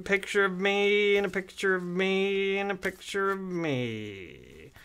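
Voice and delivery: slow, high held chant sliding down; singsong showman drawing out the words; hammed-up prize pitch. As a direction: intone each repeat, then let it sink. Possessed prize pitch, repeating himself.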